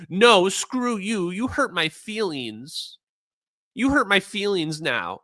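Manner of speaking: whiny mock. Mocking whiny alien believers.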